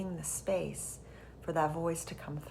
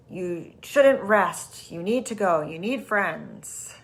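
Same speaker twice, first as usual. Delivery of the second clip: loud, slow, insistent pressure; pressured ego voice; 10 dB louder, slower than usual. Acted. The anxious ego pressuring someone to go out, loud and slow. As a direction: lean on each word like a nagging inner critic. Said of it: "the tightness and the pressure in that voice".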